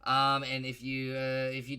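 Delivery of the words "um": ordinary talk, nothing marked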